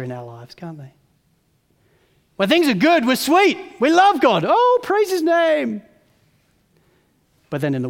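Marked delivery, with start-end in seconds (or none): 2.4-5.8 higher and louder, praise stretched slow; mock gushing praise. Believers praising God only in good times, mock gushing. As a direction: play the fair-weather believer. Mocking fair-weather faith.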